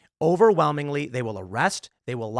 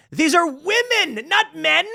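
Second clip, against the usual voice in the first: higher and slower, punching each word; slow, high insistence on women, not men; emphatic correction; 11 semitones higher, 4 dB louder, slower than usual. Real, not acted. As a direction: drive home that it's women.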